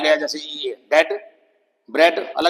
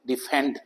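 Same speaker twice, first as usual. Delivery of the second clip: Defend softly, weight on FEND; second-syllable stress; 3 semitones lower, 6 dB quieter than usual. Shown as a demonstration. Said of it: "बाद वाले syllable पे". (Defend में ज़ोर "fend" पर)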